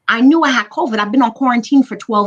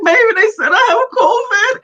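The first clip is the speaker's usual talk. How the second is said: crying; 14 semitones higher, 5 dB louder than usual. Retold or imitated. Crying to her husband as she shares her covid news. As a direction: voice breaking high into a sob. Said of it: "I was hysterical".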